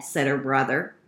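plain reading voice

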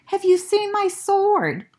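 high, lightly voiced child asking; a small boy asking his mom, up high; young turtle asking. Franklin asks mother about sword, high childlike voice. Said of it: "Franklin asked his mother".

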